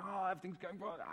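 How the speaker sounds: high, flustered headless-chicken voice; mock panic. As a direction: flap in comic panic without shouting. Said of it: "runs around like a headless chicken".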